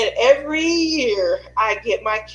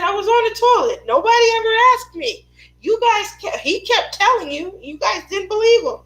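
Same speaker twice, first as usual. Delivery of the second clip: high, loud, fast defensive excuse; own childhood excuse; 10 semitones higher, 4 dB louder than usual. Retold or imitated. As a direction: rattle off the defense. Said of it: "I said".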